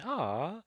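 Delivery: soft, high swooping "aw"; touched "aw". Retold or imitated. Reviewer recalling the film's sweet moments, touched "aw". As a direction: coo it softly. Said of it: "I was going".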